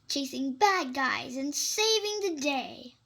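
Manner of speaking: ordinary delivery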